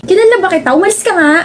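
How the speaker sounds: high, sharp brush-off; annoyed. Acted. Girl tells a stranger to go away, annoyed. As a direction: shoo him away.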